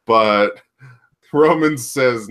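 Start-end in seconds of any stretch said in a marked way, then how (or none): none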